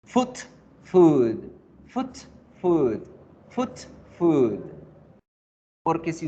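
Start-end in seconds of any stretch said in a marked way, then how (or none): 0.1-4.6 clipped "foot", drawn-out "food"; foot versus food. Shown as a demonstration. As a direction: contrast "foot" and "food" clearly. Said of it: "la pronunciación de la vocal y la terminación consonántica".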